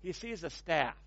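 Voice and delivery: "staff" with a flat American a, /stæ/; imitating an American saying "staff" with a short flat a; mock American accent. Shown as a demonstration. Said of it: "isn't that awful".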